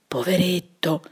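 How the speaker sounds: put-on a girl whispering in sympathy; murmured pity. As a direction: breathe it out in pity. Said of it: "mormorò Graziella".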